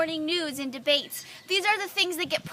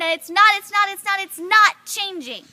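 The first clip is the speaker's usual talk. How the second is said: loud, stuttering repeats slowing onto the last phrase; insistent refusal; 3 semitones higher, 9 dB louder than usual. Acted. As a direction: hammer each NOT, loud and emphatic. Poem builds to an insistent refusal.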